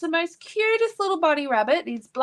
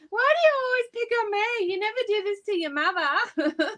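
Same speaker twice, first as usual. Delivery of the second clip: whiny husband; 8 semitones higher than usual. Retold or imitated. Husband complaining, mimicked.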